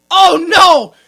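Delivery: loud, high, hammed-up dismay; over-the-top distressed girlfriend; mock horror. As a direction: wail it in pretend horror, breaking into laughter. Acts girlfriend's reaction to charades.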